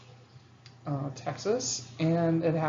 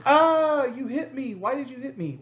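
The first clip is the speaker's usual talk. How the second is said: whiny younger sibling; 8 semitones higher, 5 dB louder than usual. Retold or imitated. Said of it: "sibling kinda goes".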